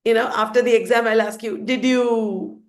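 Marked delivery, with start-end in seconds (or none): 1.6-2.6 unfinished question, last word held; left unfinished. Shown as a demonstration. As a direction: leave "you" hanging.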